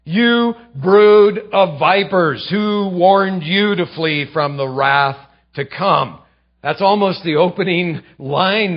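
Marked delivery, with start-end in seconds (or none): 0.0-6.2 slow, booming rebuke of the crowd; louder, slow condemnation; thundering prophet. Retold or imitated. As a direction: thunder it like John the Baptist. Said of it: "John the Baptist thundering".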